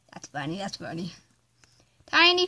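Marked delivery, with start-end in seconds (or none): 0.0-1.1 laughing softly. Real, not acted.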